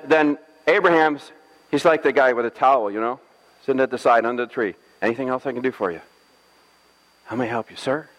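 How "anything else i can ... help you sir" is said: softer and fast, like a waiter; lightly voiced attentive host; eager servant.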